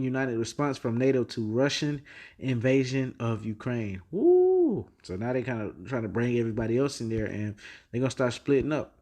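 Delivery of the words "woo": high, loud woo of excitement; a sudden delighted whoop; excited whoop. Real, not acted. Reacting to war news.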